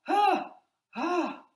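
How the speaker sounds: high, loud yelps of fright; mock panicked yelps; startled jump. Shown as a demonstration. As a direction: yelp as if your tail hit you. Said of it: "this is how I would do it".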